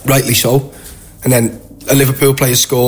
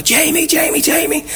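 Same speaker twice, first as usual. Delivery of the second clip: imitated excited women. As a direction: mimic women fans calling out in falsetto. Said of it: "these three women ... come running out".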